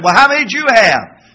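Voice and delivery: smug taunting question; 1 mock taunt. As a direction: needle them with the question. Mocking a boastful member.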